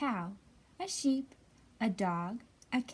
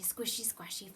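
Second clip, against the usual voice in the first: ordinary delivery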